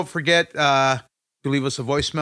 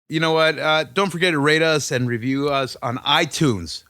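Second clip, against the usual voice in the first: usual speaking voice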